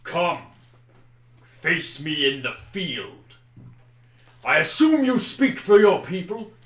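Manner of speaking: loud, commanding call to single combat; orc leader's challenge. Acted. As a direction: challenge him loudly as a rival champion.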